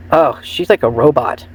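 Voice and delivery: ordinary talk, nothing marked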